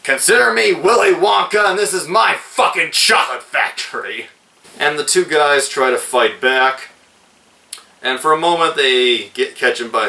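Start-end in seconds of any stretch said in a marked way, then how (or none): 0.0-4.3 high shouted villain line, then easing down; hammed-up gunpoint yell; mock scream. Reviewer reenacts Otis screaming at the man with a gun, high mock yell. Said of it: "and screams".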